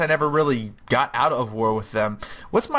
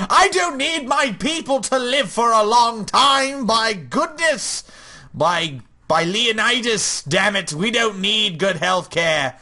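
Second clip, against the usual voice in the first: higher, slow hammed-up Greek ruler accent; mock Spartan king boasting, drawn out; 6 semitones higher than usual. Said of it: "How do you do a Greek accent anyway?".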